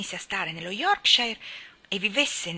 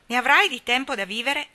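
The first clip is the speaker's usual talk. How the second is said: louder brusque reassurance; snapping back; 4 semitones higher, 7 dB louder than usual. Acted. Mary to Colin, brushing off his fears louder and brusquely. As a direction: brush off his fear sharply. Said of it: "scattò Mary".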